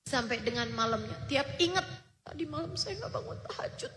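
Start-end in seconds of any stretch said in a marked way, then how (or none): 2.2-3.9 retold grief, much softer and higher; imitating a father's quiet, faster lament; hushed, broken regret; tearful murmur. Grieving father recalls missed prayer.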